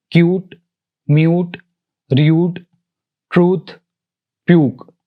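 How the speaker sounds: "क्यूट, म्यूट, र्यूट, ट्रूथ, प्यूक" read one by one with a long U; long U sound. Shown as a demonstration. Teacher giving examples of long U, long-vowel demo in "क्यूट, म्यूट, र्यूट, ट्रूथ, प्यूक". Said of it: "यू की sound है वो long sound".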